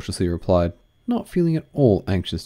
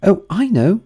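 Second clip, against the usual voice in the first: excited exclamation; 13 semitones higher, 6 dB louder than usual. Acted. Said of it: "exclaimed Alice".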